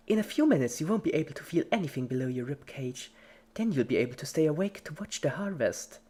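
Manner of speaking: fast, high and chipper; creepy cheerful Pinkie. A narrator's reading. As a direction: cheerfully explain the horror. Pinkie voiced by narrator.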